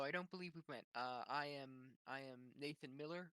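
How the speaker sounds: usual speaking voice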